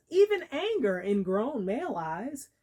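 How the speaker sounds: ordinary talk, nothing marked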